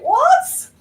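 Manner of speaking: high, loud "what?" of disbelief; incredulous. Retold or imitated. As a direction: shoot up high in mock disbelief. Reacts to sister's egg talk.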